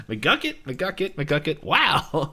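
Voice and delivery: high-pitched chant to himself, ending on a stretched word; lightly voiced babbling old man; Old Man McGucket. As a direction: repeat it in a high, rising voice. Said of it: "Old Man McGucket shows up".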